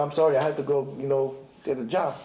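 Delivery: low, mumbled excuse-maker; sheepish excuse. Pretend. Congregant making a lame excuse to the Torah, sheepish and low. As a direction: shrug it off lamely.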